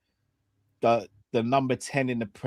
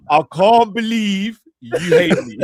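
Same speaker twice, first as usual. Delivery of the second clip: mock hurt, laughing; 5 semitones higher, 9 dB louder than usual. Teasing mock offence, laughing.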